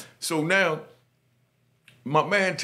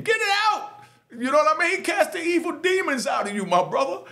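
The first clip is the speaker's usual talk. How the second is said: mock preacher; 10 semitones higher, 3 dB louder than usual.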